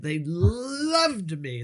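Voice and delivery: gushing emphasis, LOVED held long; drawn-out LOVED. Real, not acted. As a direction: linger hugely on the word LOVED. Boasts of a triumphant audition.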